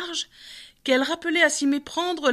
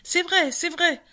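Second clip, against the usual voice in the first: high, eager agreement; confirming; 7 semitones higher than usual. A narrator's reading. The other animals backing what the bear admitted, high-voiced confirmation. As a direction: chime in as a crowd, pitched up. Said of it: "confirmèrent les autres bêtes".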